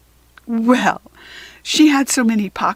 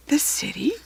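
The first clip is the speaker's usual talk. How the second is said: awed whisper; 5 semitones higher, 4 dB quieter than usual. Acted. Katy wonders aloud.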